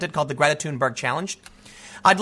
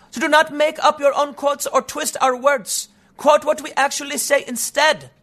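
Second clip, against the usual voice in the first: high, even-pitched mocking read; put-on high activist voice; mock Greta Thunberg; 7 semitones higher than usual. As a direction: imitate a young activist in a raised monotone. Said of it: "She said".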